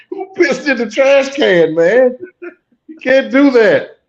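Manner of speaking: loud, high, slow protest; hammed-up disgusted outrage; appalled reaction. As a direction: complain as if the smell just hit you. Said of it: "That was my reaction to the trailer".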